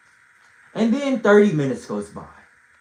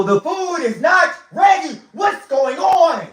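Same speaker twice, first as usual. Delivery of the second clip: higher, louder shout, last words stretched slow; frustrated shout; 8 semitones higher, 6 dB louder, slower than usual. Retold or imitated. Hungry guest yelling at the kitchen about the late food, frustrated shout. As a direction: yell it out, fed up with waiting.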